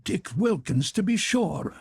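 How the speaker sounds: delighted recognition. A narrator's reading. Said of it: "said Scrooge to the ghost".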